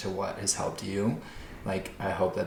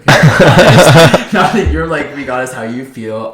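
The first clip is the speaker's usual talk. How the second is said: quoting his buddy through real laughter; words breaking into laughter, higher and louder; laughing; 6 semitones higher, 20 dB louder, slower than usual. Real, not acted. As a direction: laugh your way through the quote. Laughing while retelling the brewery moment.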